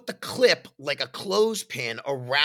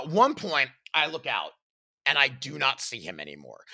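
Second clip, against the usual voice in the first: ordinary talk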